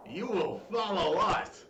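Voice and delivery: slow, threatening command; stern inquisitor. Acted. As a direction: threaten with cold calm.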